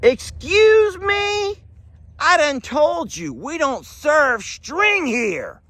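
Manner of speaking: very high, drawn-out outraged barman; indignant bartender. Acted. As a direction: stretch out the indignant excuse me.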